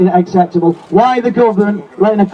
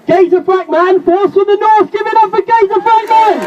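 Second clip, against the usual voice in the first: much higher, building excitement to the name; rally MC bringing the next speaker up; hype introduction; 12 semitones higher than usual. Real, not acted. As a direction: announce him like a boxing champ. Hyping a speaker onto the stage.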